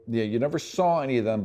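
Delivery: ordinary talk, nothing marked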